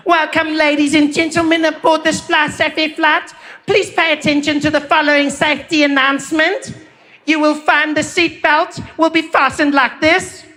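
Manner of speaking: high, slow flight attendant announcement voice. Retold or imitated. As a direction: read it like a cabin crew safety demo. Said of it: "she's like".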